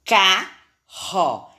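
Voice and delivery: CARRO split in two, strong RR. Shown as a demonstration. Teacher going over the syllables, strong R in "Carro". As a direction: sound out "Carro" slowly with a strong R. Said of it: "esse som saiu forte".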